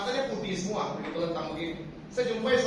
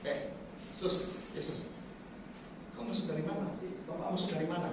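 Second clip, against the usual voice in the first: softly acted puzzled question, much quieter; hushed puzzled questioning; 10 dB quieter than usual. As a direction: drop to a cautious, quiet voice. Acts out questioning a suddenly appearing Jesus.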